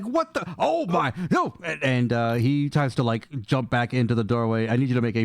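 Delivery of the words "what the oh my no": startled goblin. Acted. Goblin startled at open door.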